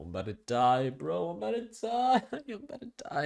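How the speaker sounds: hammed-up dying moan, going high; mock agony, pitch soaring; whiny wail. High, retelling stomach pain.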